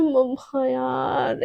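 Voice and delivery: very slow, dragged-out groggy whine; drained whine. Acted. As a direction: stretch it out like you can barely move. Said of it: "एकदम मरी-मरी सी हालत में".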